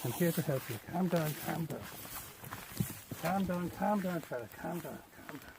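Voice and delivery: far off, calming a stuck sheep; murmured reassurance from a distance; soothing a sheep. Real, not acted.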